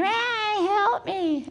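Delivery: high-pitched imitated child's wail; screaming. Pupil running up to teacher, imitated screaming. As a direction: mimic a child screeching for help. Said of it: "screaming at me".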